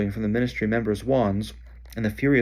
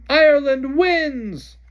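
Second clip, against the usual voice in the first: high, loud, slow stretched announcement; announcer shout; 8 dB louder, much slower than usual. Acted. Bagman announcing the result to the stadium, booming. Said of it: "Bagman shouted".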